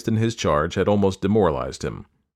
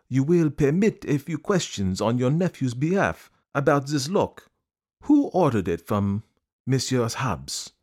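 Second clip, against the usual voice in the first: higher, slow, courteous detective; Poirot polite; 6 semitones higher than usual. A narrator's reading. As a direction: ask the bankers with deliberate politeness. Poirot questioning managers.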